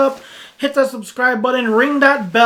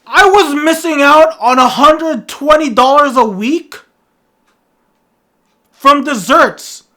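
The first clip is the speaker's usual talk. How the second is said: loud, raised-pitch disbelief; outraged outburst; 5 semitones higher, 8 dB louder than usual. Acted. Vlogger realizing the lost dessert money, outraged. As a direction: cry out in comic outrage.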